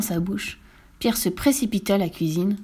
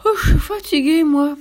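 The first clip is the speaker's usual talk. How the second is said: put-on high, loud yawning child voice; tired little boy, words stretched by a yawn; 7 semitones higher, 6 dB louder than usual. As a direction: talk through a big yawn. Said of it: "rétorqua Pierre en bâillant".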